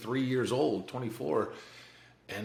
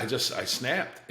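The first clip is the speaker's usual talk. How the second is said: usual speaking voice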